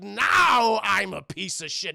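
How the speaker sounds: slow, loud, grand ham actor; Clayface hamming it up. Retold or imitated. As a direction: deliver it like a proud bad actor, slowly. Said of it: "He's like".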